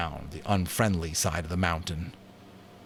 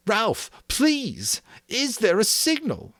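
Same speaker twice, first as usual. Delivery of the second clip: high, urgent pleading; 4 dB louder, slower than usual. A narrator's reading. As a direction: beg desperately. Simon begs Ralph.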